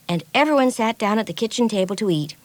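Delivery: usual speaking voice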